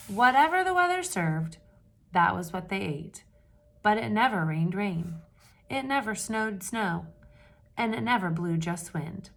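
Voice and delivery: steady read-aloud storytelling voice; story narration. A narrator's reading. Teacher reading a picture book to the class, storytelling voice.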